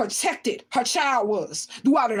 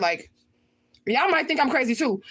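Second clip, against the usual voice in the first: usual speaking voice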